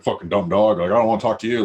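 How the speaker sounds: fake-snarl at the dog you love; mock grumpy dog owner. Telling off the dog in a hammed-up angry voice. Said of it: "you can't be like".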